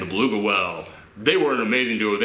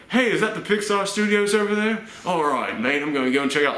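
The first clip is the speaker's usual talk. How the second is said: higher-pitched mock Australian Idris Elba; Australian accent; 8 semitones higher than usual. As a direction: put on a jokey Australian accent. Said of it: "I don't know why he's Australian in my version".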